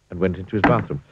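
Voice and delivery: ordinary delivery, nothing marked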